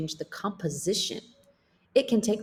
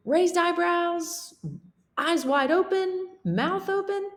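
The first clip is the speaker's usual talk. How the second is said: high character voice; 11 semitones higher than usual. Acted. Teacher reads character dialogue.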